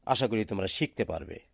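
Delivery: normal talking voice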